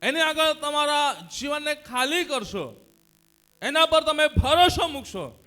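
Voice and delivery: high, emphatic sermon voice; forceful preaching. Real, not acted. Preacher warning the congregation about misplaced trust, forceful preaching.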